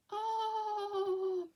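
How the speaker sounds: long, high oh of dismay; dismayed realisation. A narrator's reading. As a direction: draw out a horrified storybook oh. Rocket is being eaten.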